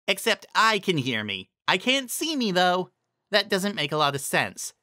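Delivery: put-on a young mare working out a riddle, bemused; puzzled Trixie voice. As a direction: think it through aloud, bemused.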